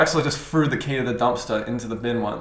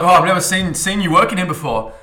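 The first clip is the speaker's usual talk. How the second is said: customer; 5 semitones higher, 7 dB louder than usual. Retold or imitated. Customer voiced in story.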